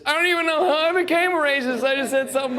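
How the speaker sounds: high, fast, frantic whine; panicked self. Retold or imitated. Cornered comic at the party, high frantic whine. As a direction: babble it out in a squeaky panic.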